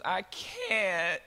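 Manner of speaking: put-on high, whiny little-girl voice; sleepy little girl. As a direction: play the exhausted little daughter. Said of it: "she goes".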